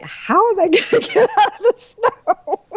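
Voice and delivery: high, laughing helpless question. Retold or imitated. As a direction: laugh through it, stuck in the snow.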